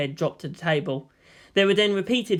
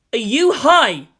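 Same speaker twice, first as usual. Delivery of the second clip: loud, higher disbelieving burst; incredulous; 7 semitones higher, 8 dB louder, slower than usual. Acted. Shinji stunned by Yang's offer, loud disbelief. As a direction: blurt it out in disbelief.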